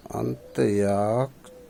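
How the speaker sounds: अंत्याक्षरी drawn out syllable by syllable, slowly; slow spelled-out word. Shown as a demonstration. Teacher slowing down अंत्याक्षरी for students. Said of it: "अंत्याक्षरी देखिए".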